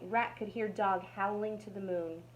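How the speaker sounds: ordinary delivery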